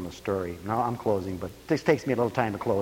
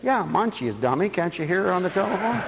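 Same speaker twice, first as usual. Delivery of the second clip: mock scorn; 7 semitones higher than usual. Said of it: "in my critical spirit again".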